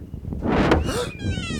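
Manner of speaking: high gasp of alarm; a sudden startled gasp at the door; sharp frightened gasp. Acted. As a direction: suck in breath in fright.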